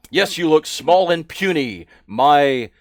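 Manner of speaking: loud, scornful orc guard; mocking orc. Orc guard mocking the small recruit, loud scorn. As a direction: sneer down at the puny one.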